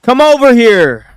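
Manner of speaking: loud summons from across the room; loud call. Shown as a demonstration. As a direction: call someone over loudly. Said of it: "How am I supposed to make this sound right?".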